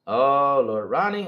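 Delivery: normal talking voice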